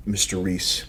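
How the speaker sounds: normal talking voice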